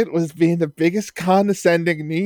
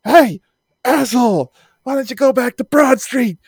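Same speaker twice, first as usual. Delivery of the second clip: higher-pitched mock heckler yelling insults; heckling New Yorker; 6 semitones higher than usual. Hostile New York fan taunts the Canadian outside, higher mock jeer. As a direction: sneer it like a drunk heckler outside the stadium. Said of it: "he was like".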